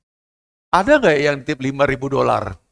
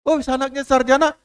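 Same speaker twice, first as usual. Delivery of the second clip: much higher, sneering doubtful gossip; mocking gossip; 4 dB louder than usual. Relatives gossiping doubtfully about the widow, high sneering voice. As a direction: scoff at the widow's hopes. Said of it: "Orang lain bersaudara kata".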